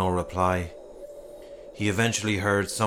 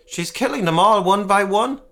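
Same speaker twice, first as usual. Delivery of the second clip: much higher and louder, frantic; frightened man in the town hall uproar; panicked cry; 10 semitones higher, 7 dB louder than usual. Acted. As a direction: cry it out in alarm.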